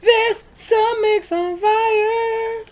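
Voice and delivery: high, very slow cry; high strained wail. Acted. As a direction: stretch it up in a pained wail. Stretched high repeat.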